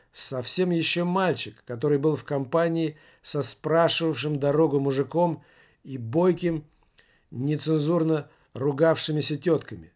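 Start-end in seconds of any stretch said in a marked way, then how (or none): none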